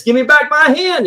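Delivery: tug and demand, hammed up; trapped Brer Rabbit. Brer Rabbit stuck in the tar, demanding his hand back. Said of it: "he says".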